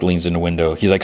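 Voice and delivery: usual speaking voice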